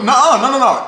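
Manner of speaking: high, rapid-fire protest; insistent objection. Real, not acted. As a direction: cut in to correct them. Arguing over who woke up when.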